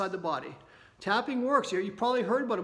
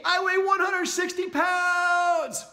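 much higher, louder and slow, shouting it; emphatic declaration; 8 semitones higher, 4 dB louder, much slower than usual. Shown as a demonstration. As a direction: declare it with full emotion. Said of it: "hear that with that emotion".

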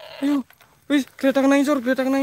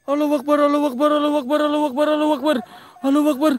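rapid takbir; 2 semitones higher, much faster than usual. Acted. Rapid takbir cry in game.

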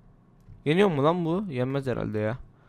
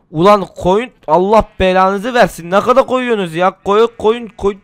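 loud, higher frustrated shout at the sheep; angry yelling; 6 semitones higher, 8 dB louder than usual. Real, not acted. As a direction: bark curses at the sheep. Koyunlara kızıp bağırıyor. (koyunlara öfkeyle bağırma)

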